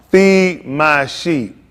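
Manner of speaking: very slow, weighty Feed my sheep; slow final command. Retold or imitated. Jesus gives Peter the final charge, very slow and weighty. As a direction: lay each word down like a last order.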